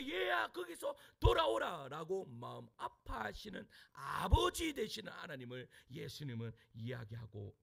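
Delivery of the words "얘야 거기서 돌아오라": pained calling out. Retold or imitated. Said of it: "마음 아파하며".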